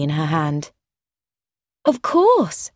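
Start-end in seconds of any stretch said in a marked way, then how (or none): 1.8-2.7 high, sudden exclamation; crying out. A narrator's reading. Woman remembering an introduction is due, crying out. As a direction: pitch it up as in sudden realisation. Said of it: "cried Jennifer".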